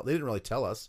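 ordinary talk, nothing marked

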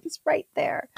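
breathy high whisper, pointing out the obvious; exasperated whisper. Retold or imitated. Mom to the kids, whispering that dad is right there. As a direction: hiss it like he's right in the next room.

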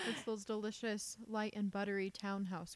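chewing while talking; words through a mouthful of cracker; mouth full. Real, not acted. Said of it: "just put a cracker in my mouth".

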